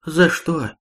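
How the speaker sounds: bewildered hurt. A narrator's reading. Крыс to Хонда after a slap, bewildered and hurt. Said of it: "с недоумённой обидой".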